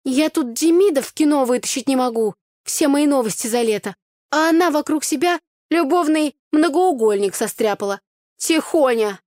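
high, loud, animated complaint; worked-up, high-pitched teasing; mock outrage. A narrator's reading. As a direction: exclaim in playful indignation at your friend. Friend's mock outrage at her love life.

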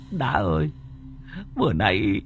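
very high, frantic panic; Tùng telling Đã about the killer doll in terror; terrified. Acted. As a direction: gasp it out like a hunted man. Friend recounts the doll ghost.

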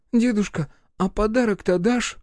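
put-on high child voice asking sweetly; hopeful boy. A narrator's reading. Boy asks grandpa Мороз for a present in a high voice. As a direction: ask like a hopeful little boy.

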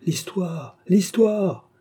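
very high-pitched crowd demand; clamouring. A narrator's reading. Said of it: "demanda-t-on à grands cris".

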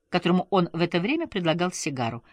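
usual speaking voice

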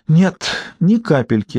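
slow, a touch of sadness; sadly. A narrator's reading. Ruth answers Alice about Peter's looks, mildly sad. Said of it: "грустно сказал Руфь".

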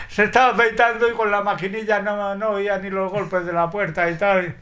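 imitated high voice breaking into laughter; laughing with relief. Josefina relieved that he was only shaving, laughing. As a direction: laugh it off as she explains.

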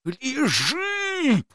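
high strained shout; shouted over gunfire. A narrator's reading. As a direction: yell it over the noise. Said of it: "прокричал я сквозь грохот".

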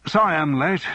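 lightly voiced shout to his guest. A narrator's reading. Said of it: "he shouted as he hung up".

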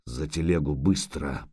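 low rasping hoarse command. Acted. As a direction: rasp it out low and urgent. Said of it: "прохрипел незнакомец".

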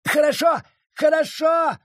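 high panicked cry; shouting. Acted. Judge cries out to Abita in panic as the blade goes in. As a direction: yell it in panic, pitch way up. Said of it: "вскричал судья".

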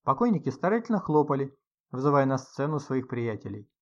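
ordinary delivery

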